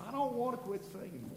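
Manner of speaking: ordinary delivery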